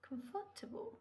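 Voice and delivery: a high rising "comfortable" for the class; model word. Shown as a demonstration. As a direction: present "comfortable" clearly as the new word. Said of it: "teacher models new word".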